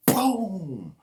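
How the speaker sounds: loud, high-pitched "boom" sound effect; booming impact noise. Acted. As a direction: burst out the explosion noise. Imitated crash in the story.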